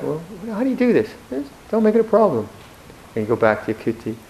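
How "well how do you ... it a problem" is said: lightly voiced exchange, higher with a laugh in it; retold student and master. Monk recalling questions to his teacher, mock voices. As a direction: play both sides of the dialogue, a touch amused.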